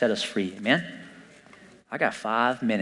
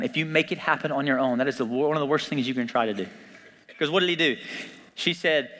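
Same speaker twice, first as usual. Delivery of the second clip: ordinary delivery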